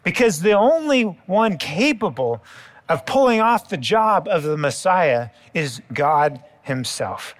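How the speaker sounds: ordinary talk, nothing marked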